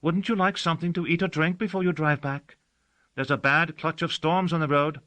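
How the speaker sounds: put-on a young girl offering her servant food before the storms; girl's voice. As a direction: kind and concerned, offering.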